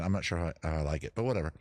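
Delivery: ordinary delivery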